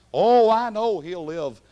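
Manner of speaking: loud, earnest protest of faith; Martha's loud reply. Acted. Preacher as Martha answering Jesus, loud. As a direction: cry it out like Martha insisting.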